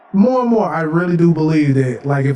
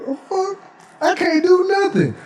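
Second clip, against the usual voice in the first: high-pitched helpless complaint; mock bafflement; 13 semitones higher than usual. Human baffled by the ancients' trick, mock bafflement. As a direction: act stunned, voice pitched way up.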